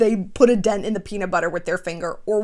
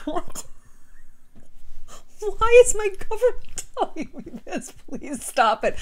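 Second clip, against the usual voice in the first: shocked disbelief; 10 semitones higher than usual. Retold or imitated. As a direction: squeal it like you can't believe it. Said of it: "I was like".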